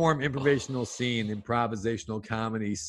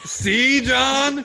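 triumphant; 6 dB louder than usual. Acted. Wife triumphant.

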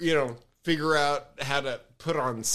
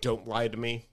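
lower, soft mock warning; a hint of a stern friend; stern Jeff; 7 semitones lower, 5 dB quieter, faster than usual. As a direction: friend on phone, mildly voiced warning. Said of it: "And he goes".

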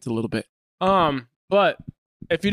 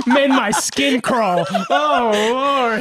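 laughing; 4 semitones higher, 4 dB louder, much slower than usual. Real, not acted.

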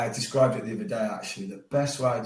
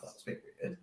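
muttered thought; 14 dB quieter, faster than usual. Retold or imitated.

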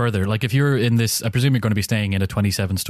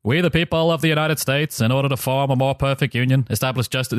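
Virginian accent; 3 semitones higher than usual. Shown as a demonstration. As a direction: recite in an attempted Virginian accent. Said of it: "try it in my best, um, Virginian accent".

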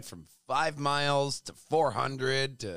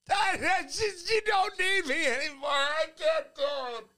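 high, loud pleading car voice; whiny car voice; 4 dB louder than usual. Pretend. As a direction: give the Jeep a high, whiny voice. Voicing the stalling Jeep.

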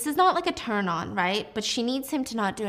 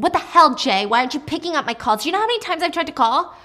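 louder, fast furious yelling at Jay; angry yelling; 6 semitones higher, 6 dB louder, faster than usual. Acted. Eve yelling at Jay for ignoring her calls, fast and loud. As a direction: chew him out, fast and loud. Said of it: "Eve is screaming at him".

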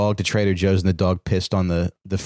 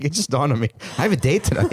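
raised-pitch teasing imitation, laughing; mimicking the co-host; 4 semitones higher, faster than usual. Teasing co-host about her date, laughing mock realization. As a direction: tease her by playing her sudden realization, amused. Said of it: "You're just like".

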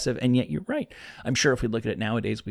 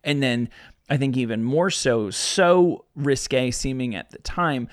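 usual speaking voice

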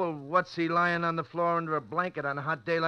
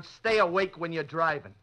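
normal talking voice

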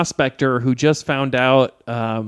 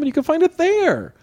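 sing-song high. Real, not acted. Sing-song end of plug.

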